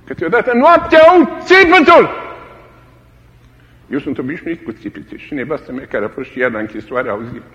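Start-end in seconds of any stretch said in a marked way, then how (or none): 0.0-2.3 high, loud anguished outcry; crying out. Retold or imitated. Preacher recalling prison cries at night, loud outcry. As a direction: call it out as if hearing it at night. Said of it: "preacher recalls cries at night".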